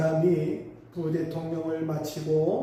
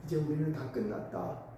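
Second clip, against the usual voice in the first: soft, understated despair; retold weeping lament; 3 semitones lower, 7 dB quieter than usual. Nine-year-old crying along with father, retold in quiet despair. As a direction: voice the hopelessness quietly, without real sobs. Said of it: "아버지를 따라서 통곡하며".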